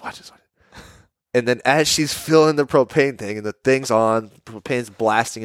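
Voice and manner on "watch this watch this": unvoiced, secretive whisper; whispered hype. Dagger nudging his friend, whispering about his joke. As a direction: whisper it like a kid with a prank. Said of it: "He goes".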